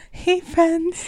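high, soft imitated greeting; customer service voice. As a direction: mimic a sugary clerk hello. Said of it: "your customer service voice every intro".

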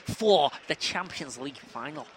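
low, slow Scouse footballer interview; Jamie Carragher. Acted. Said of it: "Jamie Carragher's up here".

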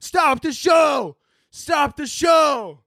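high, slow mock shout from the crowd; imitating a panicked crowd, high and slow; mock crowd yell. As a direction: call it out like a panicked concertgoer. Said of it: "They're like".

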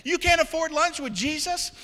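loud, high, driving sermon voice; shouting climactic sermon; fiery preaching. Acted. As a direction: preach it hard to the whole room. Preaching at full force.

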